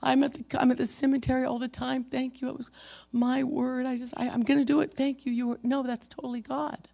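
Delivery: high-pitched, rushed imitation of a woman; grieving woman, retold. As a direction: protest in a high voice, tumbling over the words. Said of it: "She goes".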